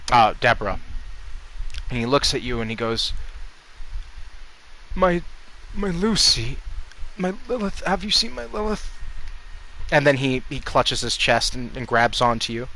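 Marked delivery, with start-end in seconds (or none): 5.0-8.9 high, frail-sounding plea from a dying man; dying old man. Acted. Dying peasant begs a stranger for news of Lilith, weak and high. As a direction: plead weakly, high in the voice, as life slips away. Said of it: "older gentleman".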